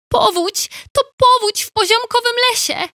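high, alarmed cry of flood; high panicked beaver shouting; frightened shout. Acted. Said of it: "krzyknęła przestraszona Bobrzyca".

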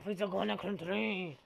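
ordinary delivery

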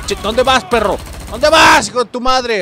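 shouted high and loud at an enemy; loud furious taunt; angry yell. Real, not acted. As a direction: bark it at the guy running away. Yelling at enemies in game.

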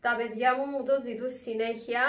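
usual speaking voice

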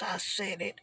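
normal talking voice

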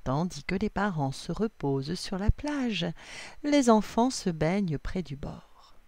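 ordinary delivery, nothing marked

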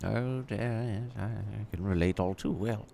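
a frail genteel lady confiding; elderly lady. Acted. Held old lady figure.

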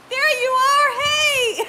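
high, loud laughing hey; excited hey. Real, not acted. Greeting a viewer she thought had left, excited laughing hey. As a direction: shout a delighted hello.

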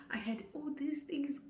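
whispered aside about herself. Real, not acted. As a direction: drop to a whisper.

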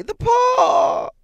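very high, loud shriek of sudden revelation; frantic old woman's piercing cry; wild cry. A narrator's reading. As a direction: shriek it wildly, stretching the last word. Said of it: "she cried wildly".

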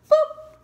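high, loud, sudden boop; needle jab sound. Retold or imitated. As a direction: squeak out a jab noise. Imitates nurse jabbing needle.

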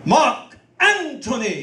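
slow, grand "Anthony" with a hard T; overdone actor's "Anthony", T for TH; copied stage pronunciation. Acted. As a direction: declaim "Anthony" as AN-too-nee, drawn out. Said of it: "This is the way you say it".

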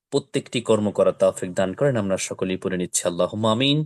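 gentle closing supplication; closing prayer. Acted.

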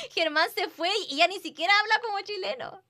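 high, loud laughing tease; laughing while teasing. Real, not acted. Streamer laughing at Germán's lost accent, high and giggly. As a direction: giggle through the jab.

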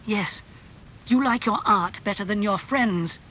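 put-on narrator's restrained angry young man. A narrator's reading. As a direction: reproach a friend with a touch of temper. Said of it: "his face red and angry".

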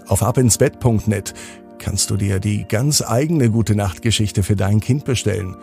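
plain reading voice

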